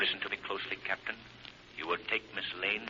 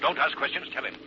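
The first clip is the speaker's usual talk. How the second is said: sharp, higher and louder, cutting her off; urgent command; 6 semitones higher, 4 dB louder than usual. Acted. Lamont urging Margot as the island is about to blow, loud and urgent. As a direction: bark the order, no time to explain. Urgent escape before the explosion.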